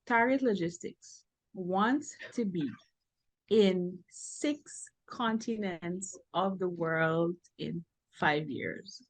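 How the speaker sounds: halting, hushed and very slow; slow, scared. Real, not acted. Business owner voicing a daunting five-year goal to her coach, slowly. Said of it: "I spoke slowly 'cause I am scared".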